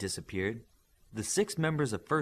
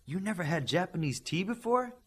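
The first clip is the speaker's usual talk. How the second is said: surprised; 8 semitones higher than usual. Acted. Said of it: "like an all-important topic just came up".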